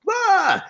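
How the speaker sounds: high, loud "ah" burst; exasperated cry. Retold or imitated. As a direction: let out a high yelp of exasperation. Acts out exasperation at agents.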